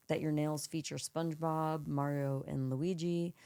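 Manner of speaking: ordinary delivery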